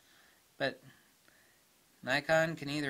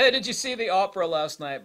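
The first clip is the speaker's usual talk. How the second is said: mock rich old man; 7 semitones higher, 9 dB louder than usual. Mocking rich old men chatting.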